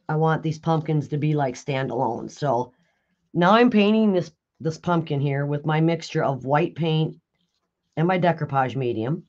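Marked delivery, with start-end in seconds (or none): none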